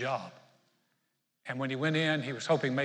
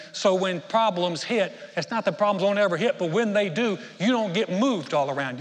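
louder, emphatic pulpit voice; preaching at full force; 3 semitones higher, 6 dB louder than usual. Acted. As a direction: drive it home to the congregation. Preaches to the congregation.

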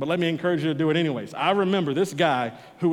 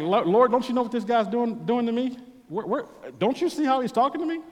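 much higher, whiny protest to God; indignant complaint; 8 semitones higher than usual. Retold or imitated. Pastor protesting to God about the man who insults him, higher and indignant. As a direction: plead your case like a wronged child. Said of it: "I'm like".

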